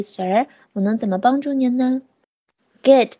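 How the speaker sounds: normal talking voice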